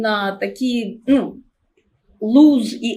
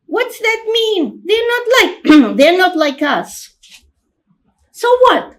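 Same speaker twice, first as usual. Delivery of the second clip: much higher and louder, fast and indignant; a defiant small child; little girl's voice; 7 semitones higher, 5 dB louder than usual. Acted. Little sister protesting she won't be a person, high child voice. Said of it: "children's voice".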